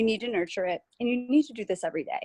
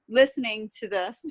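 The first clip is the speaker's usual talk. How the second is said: usual speaking voice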